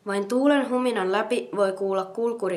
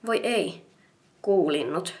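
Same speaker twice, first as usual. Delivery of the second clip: usual speaking voice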